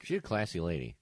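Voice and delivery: soft, low deadpan sarcasm; quietly sarcastic, as if paying a compliment. Real, not acted. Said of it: "didn't sense the sarcasm in my voice".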